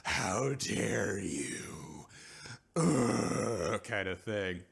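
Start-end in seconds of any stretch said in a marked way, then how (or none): 0.0-2.1 hammed-up slow outrage; overblown, very slow scolding; Trip. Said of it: "And Trip is just like". 2.8-3.8 loud guttural "ugh"; mock outraged groan.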